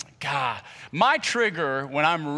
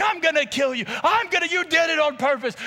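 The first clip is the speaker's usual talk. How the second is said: raging yell; 13 semitones higher than usual. Retold or imitated. Screaming at his brother in a chokehold brawl. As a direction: lose it completely while being held back.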